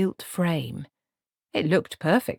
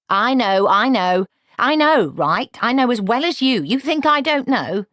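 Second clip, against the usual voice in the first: loud and higher, impatient; irritated outburst; 8 semitones higher, 7 dB louder than usual. A narrator's reading. Granny cuts off Nanny, loud and irritated. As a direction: bat the interruption away.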